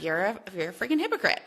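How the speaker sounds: ordinary talk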